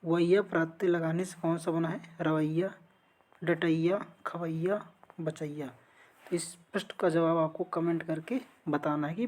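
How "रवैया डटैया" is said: ordinary talk, nothing marked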